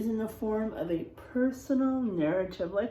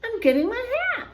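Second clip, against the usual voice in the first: put-on high, very fast, flustered kid; flustered boy; 11 semitones higher, 7 dB louder, much faster than usual. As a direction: blurt the excuse. Boy rushes excuse.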